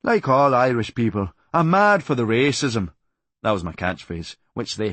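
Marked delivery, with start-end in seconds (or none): 0.0-2.9 slow, louder mock Irish accent at a comedy night; hammed-up Irish brogue, drawn out; put-on Irish accent. Comic pretending to be Irish at an Irish night, slow put-on Irish accent. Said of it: "pretended to be Irish".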